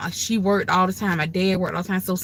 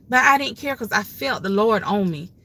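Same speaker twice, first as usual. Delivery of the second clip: ordinary delivery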